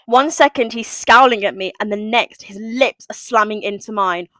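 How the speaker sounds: ordinary talk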